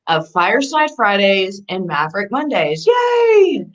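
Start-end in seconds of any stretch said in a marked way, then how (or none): 2.9-3.7 high, bright little cheer; excited cheer. Real, not acted. Host cheering her own shows to the viewers, excited.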